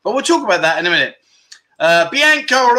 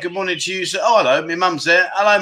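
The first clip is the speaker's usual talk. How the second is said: usual speaking voice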